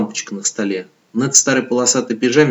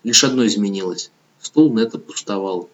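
plain reading voice

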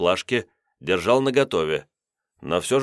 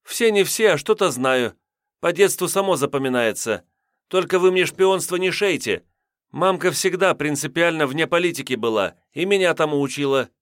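put-on defensive young woman; 8 semitones higher than usual. A narrator's reading. Redhead voiced, high.

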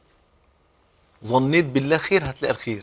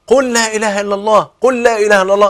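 high, loud, insistent command; a nagging, too-forceful prompt; pushy insisting; 6 semitones higher, 6 dB louder than usual. Retold or imitated. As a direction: press him hard to say it. Pushy prompting of dying man.

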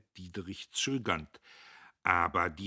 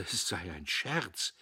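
plain reading voice

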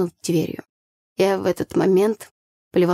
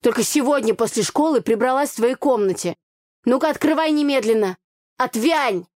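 angry scolding; 5 semitones higher than usual. A narrator's reading. Quarrel through locked door.

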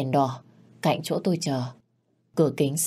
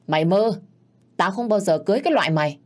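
loud, fast scornful rejection; man sneering at his lover's marriage demand; contemptuous snap; 4 semitones higher, 4 dB louder, faster than usual. Acted. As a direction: spit the refusal out quickly.

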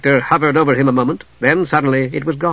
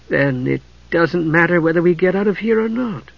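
put-on higher, plaintive young heroine voice; disheartened; 4 semitones higher than usual. A narrator's reading. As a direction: sound let down and losing hope. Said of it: "Usher sounded disheartened".

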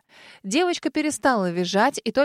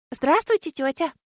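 put-on polite little girl; 5 semitones higher than usual. A narrator's reading. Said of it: "вежливо поздоровалась малышка".

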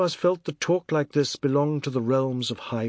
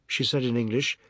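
plain reading voice

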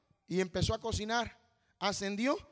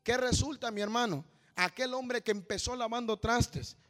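normal talking voice

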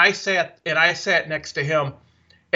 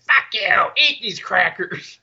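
Gilbert Gottfried; slower than usual. Retold or imitated. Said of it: "hear Godfrey just swearing at it".

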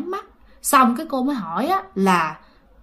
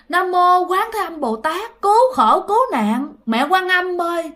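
woman begging Guanyin to save her; desperate prayer; 6 semitones higher than usual. Retold or imitated. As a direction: call out for rescue, pitched high. Woman cries out prayer for rescue.

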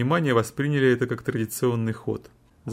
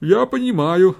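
lawyer speaking in court; 8 semitones higher, 7 dB louder, slower than usual. A narrator's reading. Lawyer's quoted courtroom speech.

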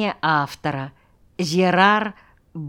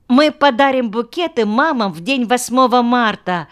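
put-on high, louder delighted little girl; bright-eyed child, pitched up; joyful; 7 semitones higher, 5 dB louder than usual. A narrator's reading. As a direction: announce it with childish glee. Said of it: "радостно заблестели чёрные глазёнки".

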